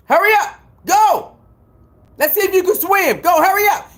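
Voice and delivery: high-pitched hurry-along commands; mock urging. Host sends Guppy off to swim, mock-impatient. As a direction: shoo them along, mockingly impatient. Mockingly ordering Guppy into the ocean.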